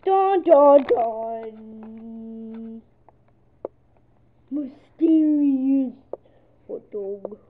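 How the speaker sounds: loud, slow mock-dramatic mysterious; loud, drawn-out mock suspense; spooky drama. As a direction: ham up the mystery. Dramatic dun dun dun.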